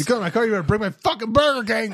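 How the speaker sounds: high, loud, hammed-up threat; mock angry retort. Cohost joining the drive-through fight, hammed-up angry yell. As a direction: bark it back like a fed-up customer.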